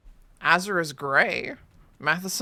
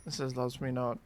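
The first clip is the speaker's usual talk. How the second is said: gloomy low mutter; 6 semitones lower, 9 dB quieter, faster than usual. Pretend. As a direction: lower and softer, glum. Petal game alternating voices.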